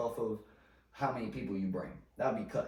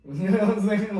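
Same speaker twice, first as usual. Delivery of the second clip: real laugh through the words, high and loud; loud, high-pitched laughing aside; 10 semitones higher, 13 dB louder than usual. Real, not acted. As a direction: burst out laughing while talking. Laughs after calling himself not gutter.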